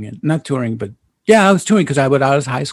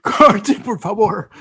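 laughing; 7 semitones higher, slower than usual. Real, not acted.

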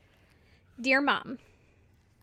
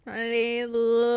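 mock drunk. Playing at slowed-down drunk talk.

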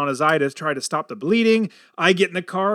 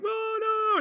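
mock kids yelling; 6 dB quieter than usual. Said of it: "They're all yelling".

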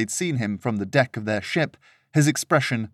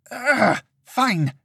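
high, pitched-up huffy surrender; exasperated groan; 12 semitones higher than usual. Acted. Serena giving in to her companion, exasperated. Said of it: "let out an exasperated groan".

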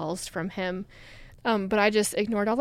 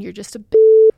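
sudden loud snap; 8 semitones higher, 14 dB louder than usual. Retold or imitated. Ex's bitter voicemail insult.

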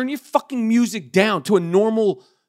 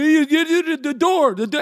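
an imitated African accent, pitched up; a lightly put-on African accent on the door complaint; mock African accent; 6 semitones higher than usual. Imitating the Uber driver's African accent as he scolds about the door. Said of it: "he had, like, some kind of African accent".

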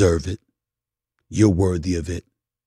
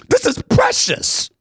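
much higher, slow and pleading; higher emotional repeat. Real, not acted. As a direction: push it up high as the feeling swells. Repeats line, rising emotion.

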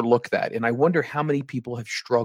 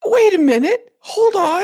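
shocked disbelief; 7 dB louder than usual. Real, not acted. Reacting to side effects list.

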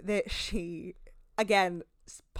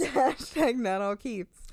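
"Hashtag not all Keiths" said slowly, higher and louder through laughter; laughing repeat; 5 semitones higher, 5 dB louder, slower than usual. Real, not acted. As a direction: laugh it out, dragging every word.

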